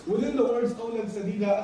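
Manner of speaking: usual speaking voice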